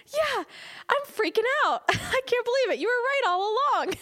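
very high, loud, thrilled imitation; freaking out. Friend admits she was wrong all along, freaking out. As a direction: squeal it out, overwhelmed with joy.

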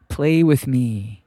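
slow, drawn-out little ghost voice; a slow, eerie child's plea to play; ghost boy. A narrator's reading. Ghost boy asks strangers to play, drawn-out and ghostly.

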